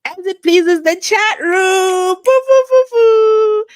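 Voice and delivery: high, loud hammed-up boo; mock booing. Streamer to chat room, hammed-up high booing. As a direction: boo the chat like a panto villain.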